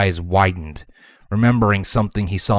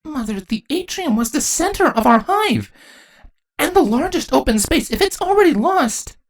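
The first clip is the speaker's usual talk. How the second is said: put-on very high young princess voice, concerned; high-pitched worry; Twilight. A narrator's reading. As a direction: plead with mother in a high voice. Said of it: "Twilight looked deeply concerned".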